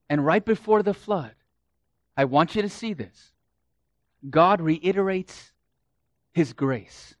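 ordinary delivery

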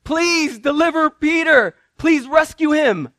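high, loud pleading prayer; praying believers pleading loudly for Peter; fervent praying church. Acted. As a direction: beg heaven urgently, slowing on please. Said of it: "they were praying".

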